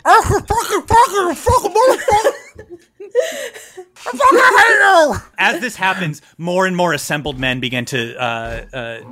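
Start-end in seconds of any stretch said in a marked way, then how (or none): 0.0-5.1 very high, loud garbled howl; hammed-up, mangled tongueless rage; tongueless shouting. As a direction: scream it as if with no tongue. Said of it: "without your tongue".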